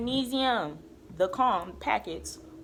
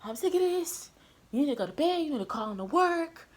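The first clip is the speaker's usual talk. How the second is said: higher, exasperated sister telling her off; sister's scolding; 8 semitones higher than usual. Retold or imitated. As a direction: imitate an annoyed sister ordering her to bed. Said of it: "She was like".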